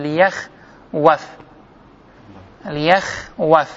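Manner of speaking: لیەخوەف slowly as li-yakh... waf; underlying form. Shown as a demonstration. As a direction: split لیەخوەف after yakh, clear w. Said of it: "ئەگە غەڵەت نەبین لە میثالەکە".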